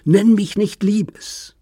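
outraged, shrill-edged rejection; furious refusal. Acted. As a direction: snap each word back at him. Daughter rejects his endearment.

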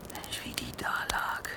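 horrified whisper. Acted. Man recalls the murdered Anna to the girl, whispering. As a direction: breathe "Mensch, wie die da lag" as a voiceless whisper.

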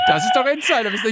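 high-pitched, amused quote; imitating someone cracking up as he speaks; retold laughing remark. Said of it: "fing er an zu lachen und".

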